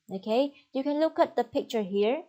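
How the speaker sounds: normal talking voice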